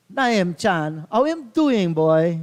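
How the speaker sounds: put-on Jamaican accent. Commentator teasing his co-host in a Jamaican accent. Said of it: "I'll say the people's accent".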